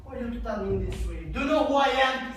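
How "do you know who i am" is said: angry yell. Acted. Drunk father yelling at family.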